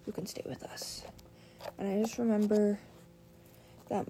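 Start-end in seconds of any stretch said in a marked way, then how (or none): 0.1-1.0 whisper. Retold or imitated. Retold call, whispered quote.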